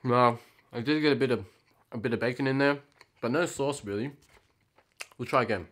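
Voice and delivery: talking between chews; chewing while talking. Real, not acted. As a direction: mouth partly full. Seen 0: Bite taken just before; lips pursed, jaw working while commenting.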